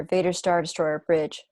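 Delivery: ordinary delivery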